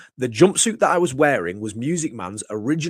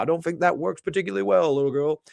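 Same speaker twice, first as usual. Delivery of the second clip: [name]-style wrestler voice, a bit higher; Music Man impression; 5 semitones higher than usual. Telling the girl off in Music Man's [name] voice. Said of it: "doing a full Music Man impression".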